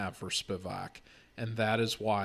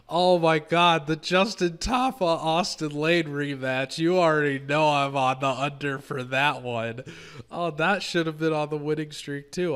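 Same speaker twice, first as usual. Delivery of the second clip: excited; 6 semitones higher, 8 dB louder than usual. Real, not acted. Hyped reaction to fight card.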